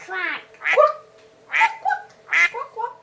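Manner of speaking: duck quacks. Shown as a demonstration. Echoing the child's duck sound, playful quacks. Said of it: "What's the sound of duck?".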